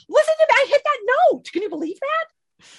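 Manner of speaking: very high, loud excited squeal; giddy bragging. Retold or imitated. Calling her husband to brag about the note, high giddy squeal. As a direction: squeal the news down the phone. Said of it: "Call my husband and".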